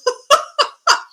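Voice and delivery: high, helpless cackle of amusement; high laughter. Real, not acted. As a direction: burst out laughing. Cracking up at her own plea.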